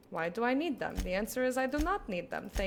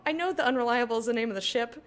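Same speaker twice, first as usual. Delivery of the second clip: ordinary talk, nothing marked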